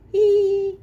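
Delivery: put-on high, loud childish giggle; a bright little storybook giggle; happy giggle. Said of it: "They giggle happily".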